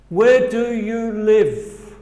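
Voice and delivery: slow, clear teacher model; slow demo question; slow model phrase. Shown as a demonstration. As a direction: say it slowly for students to repeat. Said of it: "Se pueden repetir después a mí".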